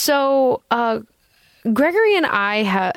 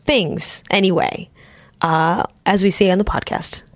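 ordinary delivery

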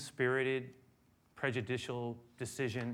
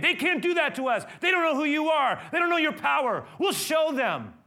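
loud, high, indignant outburst; hammed-up wounded fury; furious disciples; 12 dB louder than usual. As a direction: rage like offended followers. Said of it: "They're so mad".

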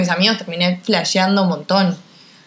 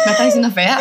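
much higher, laughing mock outrage; mock offended shriek; faster than usual. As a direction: squeal it out as if insulted, half laughing. Fingida ofensa entre amigas. (chillido de indignación fingida, riendo)

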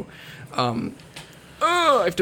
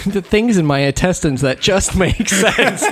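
laughing; 5 semitones higher than usual. Pretend. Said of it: "It's like".

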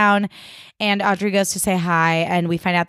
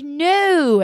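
high protest; 9 semitones higher than usual. Retold or imitated. Audrey and Aki answering Max, high yelp. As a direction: yelp a denial.

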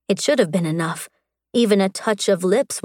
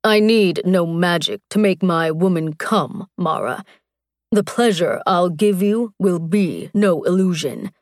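lightly voiced warrior. Strax assures his bride, lightly voiced warrior.

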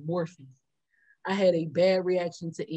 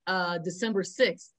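ordinary talk, nothing marked

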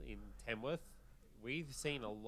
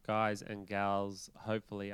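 radio host voice; 2 semitones lower, 9 dB louder, slower than usual. Acted. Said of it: "do I still have my sexy radio voice".

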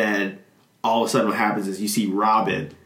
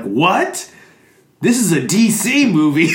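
higher, louder retold outburst of disbelief; mock shock; 7 semitones higher, 4 dB louder than usual. Stan Lee learning whose movie he's in, mock shock. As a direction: act out a startled realization. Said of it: "whispers in his ear, it's like".

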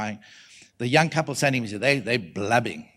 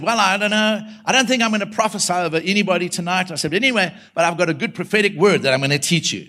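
raised, loud casual proclamation; mock announcement; 5 semitones higher, 5 dB louder than usual. Preacher telling the church he won't prophesy, loud and offhand. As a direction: project it higher to a full hall.

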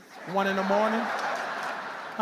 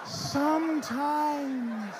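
very slow; 2 semitones higher, much slower than usual. Acted. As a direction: stretch each sometimes out very slowly. Drawn out.

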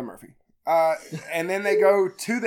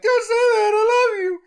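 much higher and louder, hammed-up sobbing; crying plea; 7 dB louder than usual. Sister pleading with her brother, mock sobbing much higher. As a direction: wail it like a heartbroken little sister. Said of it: "And she's like".

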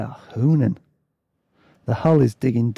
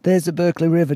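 put-on more restrained character accent, somewhat high; lightly voiced sailor, slightly raised; Dave; 5 semitones higher, 3 dB louder, faster than usual. A narrator's reading. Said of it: "so I've got to do, like, a different kind of accent".

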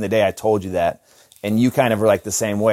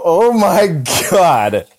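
retold disgusted shock; 5 semitones higher, much slower than usual. Retelling smelling the fart in the curtain room, slow disgusted shock. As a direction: recoil at the smell, stretching each word. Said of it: "And I go".

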